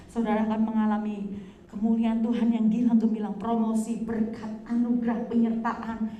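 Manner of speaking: loud, rolling sermon proclamation; preaching at full force. Acted. Preacher declaring blessings to the congregation, loud and fervent. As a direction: lift the congregation with big promises.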